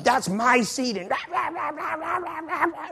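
insist, then climb into jabbering rah-rah mimicry; mock nagging. Imitating older lady claiming her seat, mock nagging.

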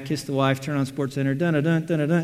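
ordinary talk

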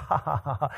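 mock laugh. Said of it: "She's like".

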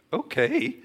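high-pitched, timid okay; scared agreement. Retold or imitated. Teacher accepts an invitation to speak, scared. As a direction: squeak out a frightened yes. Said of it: "I'm so scared".

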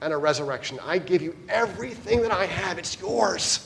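soft, quiet, reassuring; a hint of Jesus's gentle voice. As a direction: lift them up gently. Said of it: "hear the voice of Jesus saying to you".

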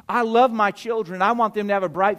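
emphatic, high-energy sermon delivery; forceful preaching. Real, not acted. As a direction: proclaim each phrase with weight and pauses.

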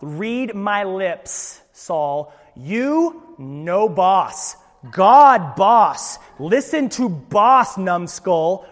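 loud, very slow, word by word; spelled out slowly. Acted. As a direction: spell it out like to a dimwit. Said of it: "He's gonna spell it out".

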